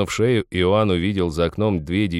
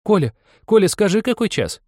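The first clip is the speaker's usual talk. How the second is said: put-on loud, very high girlish call; high-pitched girl hailing a lad; 14 semitones higher, 5 dB louder than usual. A narrator's reading. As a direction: call to a friend round the corner.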